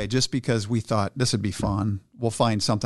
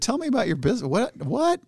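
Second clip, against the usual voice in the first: much higher, eager questions; 13 semitones higher than usual. Retold or imitated. Owner suddenly hooked by the hammock pitch, eager and high. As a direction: leap up in pitch, hungry to know. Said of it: "And I said".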